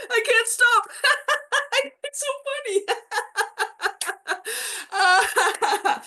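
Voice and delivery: high hysterical laughter; laughing. Shown as a demonstration. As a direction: laugh until you can't stop. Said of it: "this one is just laughing".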